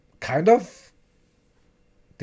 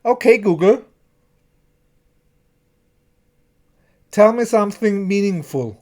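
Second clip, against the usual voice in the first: higher, louder phone assistant prompt; asking a phone assistant, slightly raised command voice; 4 semitones higher, 5 dB louder than usual. Pretend. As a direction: speak it like a command to your phone. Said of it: "like you make it on your phone".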